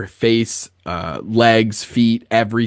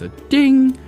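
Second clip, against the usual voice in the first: ding; 4 dB louder than usual. Acted. Imitating bell sound.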